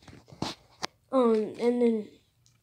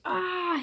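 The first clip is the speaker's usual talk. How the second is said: long high "aah"; mock scream while falling; acted falling cry; 8 semitones higher than usual. Character falls through portal.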